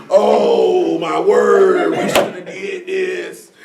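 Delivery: slow, drawn-out fake lament; mock crying. Mocking the friend crying after sinning, slow lament. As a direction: moan it like a regretful sinner, stretched out. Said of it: "You sitting in there crying again".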